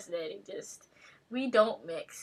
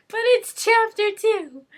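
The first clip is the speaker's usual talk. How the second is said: high, loud, slow insistence; exclaiming; 11 semitones higher, 13 dB louder than usual. Real, not acted. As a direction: insist loudly, drawing it out. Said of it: "That looks like a five".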